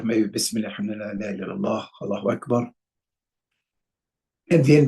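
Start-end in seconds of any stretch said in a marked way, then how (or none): 0.3-2.7 soft, murmured Arabic phrases; quiet dhikr. Shown as a demonstration. Teacher shows students a soft calming dhikr before replying. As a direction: say it softly to yourself, calming down. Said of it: "say to yourself".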